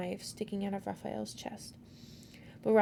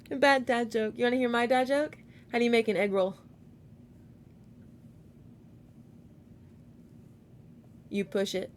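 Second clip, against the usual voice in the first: dad joke delivery; 2 semitones higher, 4 dB louder than usual. Real, not acted.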